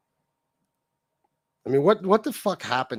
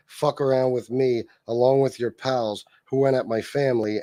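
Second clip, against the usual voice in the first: ordinary talk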